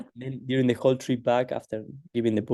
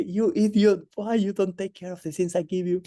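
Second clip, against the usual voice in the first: high-pitched mock telling-off; imitated outburst at a careless disciple; retold angry scolding; 8 semitones higher than usual. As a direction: scold like an exasperated guru, pitched well up. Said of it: "makes Guruji furious, you know, even worse".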